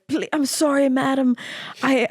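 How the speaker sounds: put-on playful Irish-accented old-fashioned plea. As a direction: lay on a mock Irish lilt. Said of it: "He has an Irish accent".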